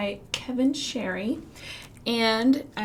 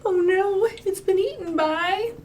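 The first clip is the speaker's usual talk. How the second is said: mock alarm; 9 semitones higher, 5 dB louder than usual. Fish gets eaten.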